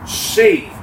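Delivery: "saved" leapt up high and falling; stress on saved. Shown as a demonstration. Preacher naming his theme word, ringing it out. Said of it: "that word at the end of the verse".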